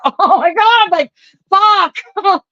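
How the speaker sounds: high, loud mock horror; shocked reaction. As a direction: gasp it out like you can't believe it. Her shocked reply to him.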